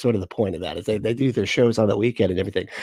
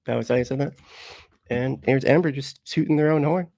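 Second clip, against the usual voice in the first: ordinary delivery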